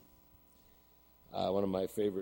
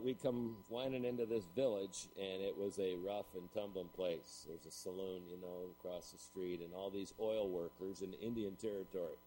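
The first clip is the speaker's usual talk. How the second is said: ordinary talk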